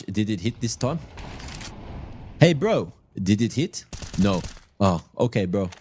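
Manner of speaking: lightly done general European accent, playing a Polish player on comms; mock general European accent. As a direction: tease with a hammed-up general European accent, "bro". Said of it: "That's my, uh, general European accent".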